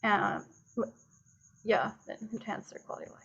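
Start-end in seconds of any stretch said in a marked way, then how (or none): 2.3-3.2 breathy whisper under the breath; whispered aside. Real, not acted. Repeats the quoted claim under breath.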